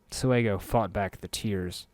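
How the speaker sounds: usual speaking voice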